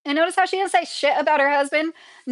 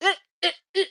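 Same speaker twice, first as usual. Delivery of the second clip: halting mock stammer, pitched up; imitating Melania Trump; 6 semitones higher, much faster than usual. Streamer mocking how hard she is to listen to, halting imitation. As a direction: stumble over broken little syllables, mocking her speech. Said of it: "she's so hard to listen to, she just".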